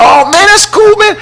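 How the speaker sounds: bright high friendly greeting; upbeat reply to fan. Retold or imitated. Host answering a truck driver fan, high and friendly. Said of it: "And I said".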